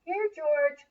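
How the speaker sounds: high caveman call. Acted. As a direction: call the dinosaur home. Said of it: "called the grunts".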